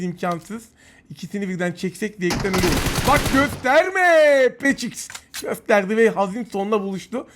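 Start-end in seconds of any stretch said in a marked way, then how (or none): 3.1-5.1 warning shout. Real, not acted. Gamer slowly warns the enemy not to peek, raised voice. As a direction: slowly warn him off.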